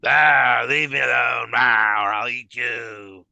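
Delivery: loud, slow monster growl; cartoonish monster voice. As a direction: threaten like a silly storybook monster. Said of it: "cartoonishly going".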